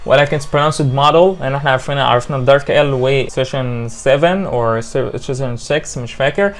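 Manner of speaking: ordinary talk, nothing marked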